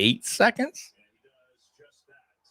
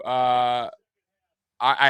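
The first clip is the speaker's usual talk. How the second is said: ordinary talk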